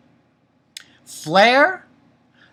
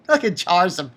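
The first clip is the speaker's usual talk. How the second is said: ordinary delivery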